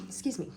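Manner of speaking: real sneeze mid-sentence; sneezing. Real, not acted. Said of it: "I'm gonna sneeze".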